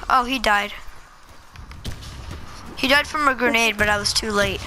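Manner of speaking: usual speaking voice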